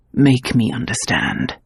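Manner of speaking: low gentle insistence. A narrator's reading.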